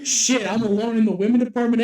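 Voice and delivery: loud, high-pitched exasperation; comic dismay. Real, not acted. Speaker realising they have no best friend, in loud comic dismay. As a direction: cry out in half-joking dismay.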